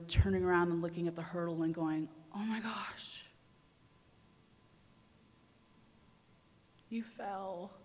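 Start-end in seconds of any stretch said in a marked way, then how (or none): 2.3-7.8 breathless stunned whisper; hushed disbelief after a hard fall; shocked whisper. Retold or imitated. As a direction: whisper it as if you can't believe it. Stunned self-quote after falling.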